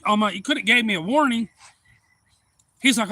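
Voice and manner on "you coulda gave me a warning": louder, higher complaint; acted indignant protest. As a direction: imitate scolding a friend after a scare. Startled man protests to shooter.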